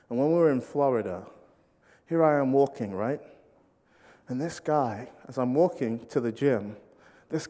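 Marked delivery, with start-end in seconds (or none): none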